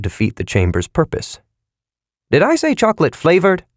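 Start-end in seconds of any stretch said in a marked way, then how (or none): 2.3-3.6 high, fast, flustered voice; flustered self-correction; agitated salesman. A narrator's reading. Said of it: "droned on in agitation".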